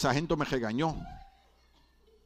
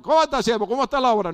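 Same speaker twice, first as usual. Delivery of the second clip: God calling the pastor; 9 semitones higher, 6 dB louder, faster than usual. Retold or imitated.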